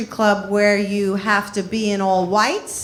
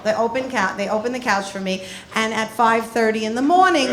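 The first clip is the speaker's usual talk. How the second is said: ordinary delivery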